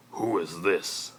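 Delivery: slow, strained restraint; forced calm. Acted. Uncle Vernon asking Harry about the stranger, forced calm. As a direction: hold your temper with effort. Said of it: "in a voice of forced calm".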